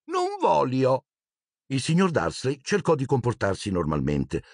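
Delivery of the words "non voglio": put-on slow, higher sulky little boy; child's refusal. A narrator's reading. Little Dudley whining his new phrase slowly. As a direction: drag it out like a stubborn toddler. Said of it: "imparato una nuova frase".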